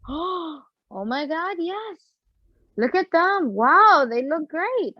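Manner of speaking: acting out Mom's high-pitched awe; retold amazed gasp. Acts out mom seeing the band.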